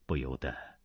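ordinary delivery

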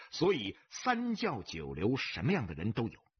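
ordinary delivery

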